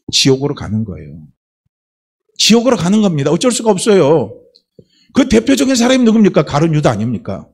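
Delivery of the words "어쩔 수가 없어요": ordinary delivery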